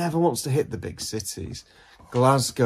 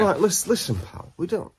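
with a bit of stank; 7 semitones higher than usual. Acted. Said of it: "saying that with a bit of stank".

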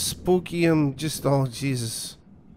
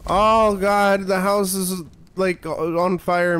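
alarmed; 6 semitones higher, 4 dB louder, slower than usual. Real, not acted. Alarmed at fire.

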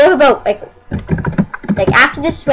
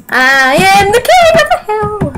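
raised, swelling proclamation; triumphant boast; 6 semitones higher, 4 dB louder than usual. Real, not acted. Player taking the lead, raised triumphant boast. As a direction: proclaim it grandly, pitch climbing. Boasts about leading the game.

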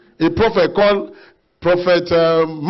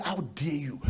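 usual speaking voice

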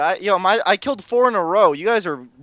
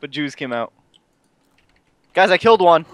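ordinary talk

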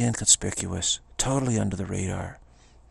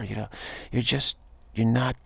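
ordinary talk, nothing marked

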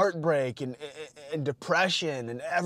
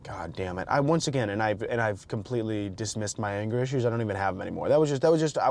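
ordinary delivery

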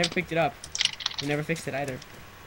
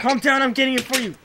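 loud, high, exasperated; exasperated shout; 9 semitones higher, 8 dB louder than usual. Real, not acted. Player fetching friend's stuff, exasperated shout. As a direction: shout over a nagging friend.

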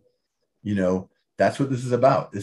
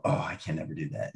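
mock defeatist, quieter and low, x1.9; defeatist whine; 8 semitones lower, 8 dB quieter, much faster than usual. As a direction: rush it out softly, lower and defeated. Quoting defeatist people.

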